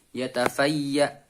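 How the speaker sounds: slow "yatafayya" ending firmly pressed on the stop. Shown as a demonstration. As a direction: press down hard on the end of "yatafayya". ("yatafayya" diwaqafkan dengan ditekan)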